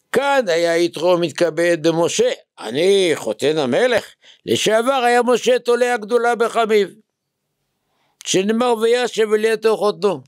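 high, ringing recitation of a text; declaimed quotation. Acted. Rabbi reading Rashi to his students, declaimed. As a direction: read the commentary out high and sustained.

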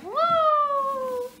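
high, loud whoop; excited cheer. Real, not acted. Playing with toddler.

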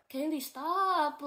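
a frightened plea to stop; imitating her scared sister's slow plea. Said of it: "She was like".

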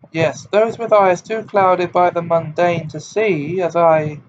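high, loud, theatrical and tragic; dramatic wavering seer. A narrator's reading. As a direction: play the persecuted prophet. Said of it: "in a deep, dramatic, wavering voice".

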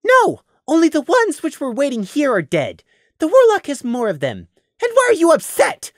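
put-on high, indignant young voice; Prey's voice, defiant. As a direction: push back, baffled and sharp.

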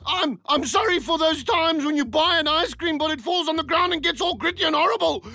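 high, melodramatic guilt; overwrought remorse. Acted. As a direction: pour out mock remorse. Coach spirals into overwrought apology.

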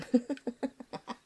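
high, rapid chuckle. Acted. Hunter jokes about the family accent, high chuckle. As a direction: laugh at your own joke.

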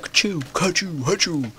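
high, soft fake achoos; badly imitated sneezes. Player demonstrates a day of sneezing, mock sneezes. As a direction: fake a run of sneezes, unconvincingly. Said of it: "That was a really bad impression".

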